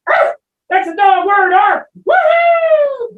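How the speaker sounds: high loud joyful cheer; thrilled shouting. Acted. Puppet dog cheering his second dog word. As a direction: whoop with delight.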